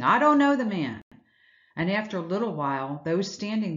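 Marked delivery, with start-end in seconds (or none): 0.0-1.0 higher and louder, snapping "I don't know the man"; angry denial. Acted. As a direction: hurl the denial back at them. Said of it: "He starts cussing".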